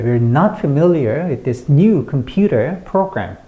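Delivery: slow, clear beats on "not", "familiar", "new", "computer", "program"; light "We're" and "with this", punch "not", "familiar", "new", "computer", "program"; clear sentence stress. Shown as a demonstration. Teacher models the full sentence, clear stress on "not", "new", "program". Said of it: "강세를 분명하게, 짧게, 정확하게".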